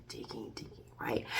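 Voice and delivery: hushed whisper, drawing listeners in. Acted. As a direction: breathe it like a secret. Dramatic hushed retelling.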